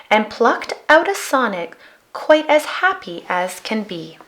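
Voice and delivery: plain reading voice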